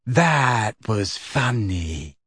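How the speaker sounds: very slow, stretched-out words; dazed, drawling amusement; dreamily amused. A narrator's reading. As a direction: draw it out, weak with mirth. Luna helpless with laughter.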